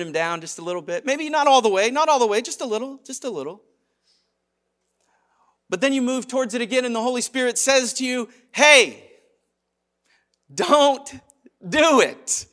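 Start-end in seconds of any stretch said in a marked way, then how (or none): 1.1-2.4 high, loud bargaining; hammed-up rationalizing; mock self-excusing. Excusing turning God down slightly. 2.4-3.6 small, soft voice dropping away; furtive little excuse; sneaky minimizing. Acted. Sneakily turning it down. 8.5-12.5 loud, high, spaced-out command; emphatic raised-voice warning; forceful warning. Acted. Holy Spirit's third, louder warning.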